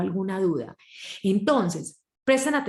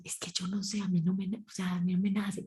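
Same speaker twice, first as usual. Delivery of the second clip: timid quiet confession; 7 dB quieter than usual. Retold or imitated. Women who cannot propose sexually.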